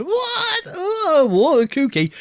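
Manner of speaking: very high, laughing mock disbelief; laughing high-pitched mock surprise; laughing mock shock. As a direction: squeal it in fake astonishment. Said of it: "doing the call and response".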